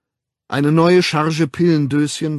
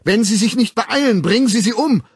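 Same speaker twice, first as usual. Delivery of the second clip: much higher, loud urgent yell; 11 semitones higher, 7 dB louder than usual. Acted. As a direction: bellow at the police to hurry. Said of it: "brüllte Bernhard".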